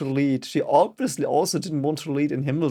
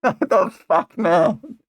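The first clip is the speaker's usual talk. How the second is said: incredulous laugh, higher; laughing disbelief; 5 semitones higher, 5 dB louder, much slower than usual. Real, not acted.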